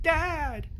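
put-on a kid's relieved call of dad; child calling out. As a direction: son calling to his father, high and relieved. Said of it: "Carl's like".

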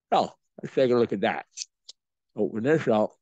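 talking around a cigar; cigar in mouth. Real, not acted. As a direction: lips clamped on cigar. Seen 0: Cigar clenched between lips throughout while he talks and unwraps.

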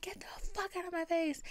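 high squealed dismissal; strangled, high pretend yell; mock scream. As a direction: imitate yelling in a small high squeal. Retold yelling while still crying.

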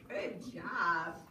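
ordinary delivery

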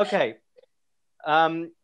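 usual speaking voice

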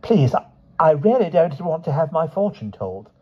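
afraid. Acted. Panicked plea.

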